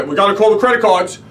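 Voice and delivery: loud, high, alarmed cry about the cards; panicked outburst. Retold or imitated. Husband losing his wallet, panicking loudly to his wife. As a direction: blurt it out in a sudden panic. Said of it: "Phase two is when I'm like".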